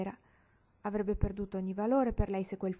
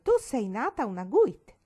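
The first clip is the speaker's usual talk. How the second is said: mildly outraged, louder and higher; outraged protest; 5 semitones higher, 7 dB louder than usual. A narrator's reading. Marigold disputes her friend's political claim, mildly outraged. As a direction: protest as if affronted by the idea. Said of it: "gridò Marigold, oltraggiata da quest'idea".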